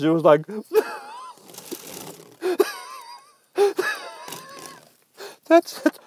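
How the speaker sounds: high hammed-up fake sobbing wail; mock crying wail. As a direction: wail like a crying gatekeeper, played for laughs. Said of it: "that's how much he was crying".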